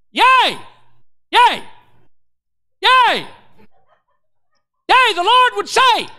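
high, loud, drawn-out "yea"; big-voiced Pentecostal prophecy; prophetic proclamation. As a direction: proclaim a word from the Lord at full voice. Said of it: "and I got a voice".

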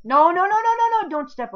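very high, loud frantic no; panicked shouting. Real, not acted. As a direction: shriek to stop them in panic. Panic as friend steps wrong.

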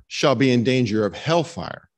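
usual speaking voice

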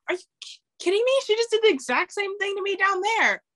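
high-pitched incredulous protest; mock indignation. Player to referee after a foul call, indignant. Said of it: "it makes me mad".

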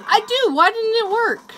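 high, loud, startled outburst; excited yelp. Real, not acted. Gamer yelps high and loud when his knife fails. Frantic mid-game knife fail.

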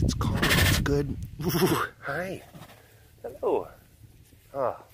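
calm the animal gently; soothing pet talk. Real, not acted. Soothing and praising the cat.

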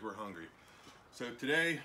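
ordinary talk, nothing marked

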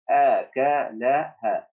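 أكلها stretched slowly, syllable by syllable; slow reading. Shown as a demonstration. Teacher modelling أكلها slowly for first graders. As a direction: draw out أكلها for the class. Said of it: "teacher models reading".

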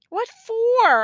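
high question, falling hard; exasperated protest. A narrator's reading. Nephew protesting to aunt.

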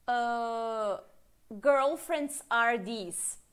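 teacher modelling whose question slowly; slow English question demo. Shown as a demonstration. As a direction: stretch whose then the rest. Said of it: "se eu perguntar assim".

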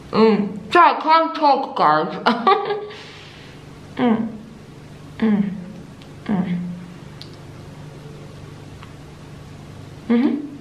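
humming with lips closed; muffled through dental tray; mouthpiece in mouth. Real, not acted. Seen 1: Lit whitening mouthpiece held between teeth throughout; only hums possible.

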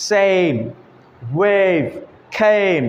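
সেম, ওয়েভ, কেম each with a drawn-out "ay"; long A. Shown as a demonstration. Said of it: "একটু লম্বা করে উচ্চারণ করতে হচ্ছে". (সেম, ওয়েভ, কেম, এ টেনে লম্বা করে)

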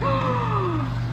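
sharp high intake of breath; high surprised gasp. Real, not acted. Finding the stickers all different, high delighted gasp.

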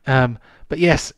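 ordinary delivery

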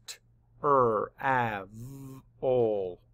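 "T, R, A, V, ol" as separate sounds, not letter names; sounded out. Shown as a demonstration. Teacher mapping the sounds of travel, sounded out. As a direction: segment it, short A and held V. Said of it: "Map the sounds".

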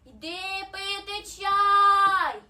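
very loud, long-drawn singsong call; far-carrying call to someone outdoors. A narrator's reading. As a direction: holler slowly, stretching every vowel. Hedgehog calls from the porch.

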